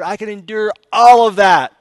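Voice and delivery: loud swell on all; loud emphatic retelling of her suffering; emphatic retold cry. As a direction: push all of that out hard. Wife retells her endurance.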